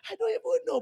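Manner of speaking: hammed-up simpering girl on a call. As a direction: squeal and giggle down the phone. Girl's coy reply.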